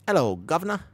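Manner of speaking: badly faked Cockney greeting; hammed-up, swooping British hello; British accent. Shown as a demonstration. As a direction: attempt a cheesy British accent. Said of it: "that's my best attempt".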